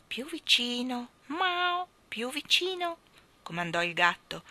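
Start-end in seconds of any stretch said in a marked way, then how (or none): 0.1-3.0 high, drawn-out cat coaxing; higher on each command, with a mew between. Acted. Cat ordering the king to come closer, commanding cat.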